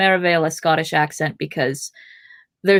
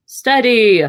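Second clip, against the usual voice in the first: loud, higher call of warning; sharp raised cry; cried out; 5 semitones higher, 7 dB louder than usual. A narrator's reading. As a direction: call it out to hold them back. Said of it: "cried Gandalf".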